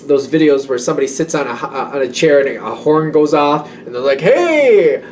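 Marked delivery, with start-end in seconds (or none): 4.2-5.0 high, loud startled hey; a quick shocked shout; startled yelp. Retold or imitated. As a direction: jump at a sudden horn. Acting horn going off.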